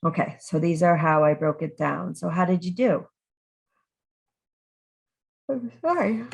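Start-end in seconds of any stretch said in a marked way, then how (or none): 5.5-6.2 talking through a yawn; stifle a yawn while speaking. Real, not acted. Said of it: "it makes me yawn".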